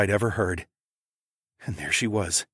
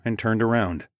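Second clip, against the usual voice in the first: plain reading voice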